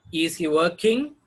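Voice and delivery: "Is he working" with "working" rising; yes-no question rise. Shown as a demonstration. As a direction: ask it, lifting the end of "working". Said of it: "These are the questions".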